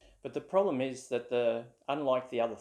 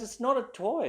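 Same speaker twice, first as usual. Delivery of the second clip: higher, louder offended insistence; indignant correction; 11 semitones higher, 4 dB louder than usual. Retold or imitated. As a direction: set him straight, offended. Friend insists it is an instrument.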